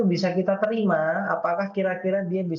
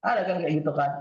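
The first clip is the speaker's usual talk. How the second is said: ordinary talk, nothing marked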